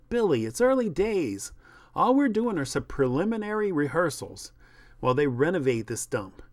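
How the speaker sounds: lightly voiced director smoothing things over; placating director. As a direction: reassure a touchy actor. Voices director calming the actor.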